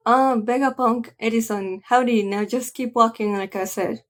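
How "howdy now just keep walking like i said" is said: usual speaking voice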